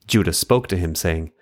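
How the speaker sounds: plain reading voice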